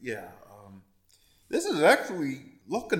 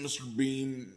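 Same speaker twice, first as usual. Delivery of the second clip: usual speaking voice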